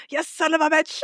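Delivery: high-pitched quoted outburst; mock movie intensity. Fan repeating the line stuck in her head, mock intensity. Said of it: "the line of, like".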